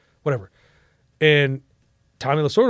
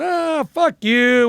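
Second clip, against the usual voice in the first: mock disbelief; 10 semitones higher, much slower than usual. Friends disbelieve the prank call.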